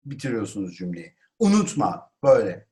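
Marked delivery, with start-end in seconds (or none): 1.4-2.1 higher-pitched Turkish exclamation. Shown as a demonstration. Teacher shows Turkish works the same, exclaimed. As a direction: cry out a reminder. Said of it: "Türkçesi de aynı".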